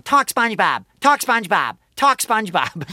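put-on high, insistent kid's demand; demanding, entitled kid. Entitled kid poking the voice actor, demanding. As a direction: poke at them with each repeated order. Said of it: "demanding entitlement and starts poking you".

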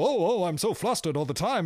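fussy, flustered screwball hero; Cary Grant impression. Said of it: "Cary Grant hike up his pants and, and go".

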